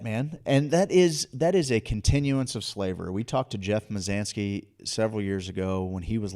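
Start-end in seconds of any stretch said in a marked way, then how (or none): none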